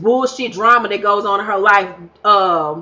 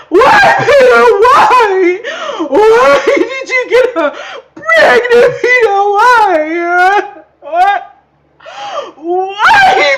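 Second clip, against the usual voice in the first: second take, overblown shrieking sobs, much higher and louder; fake crying as Amina; 11 semitones higher, 7 dB louder, much slower than usual. Mock-crying Amina begs Peter why, shrieking. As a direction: squeeze out a fake tear and wail. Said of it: "Let me get one more tear".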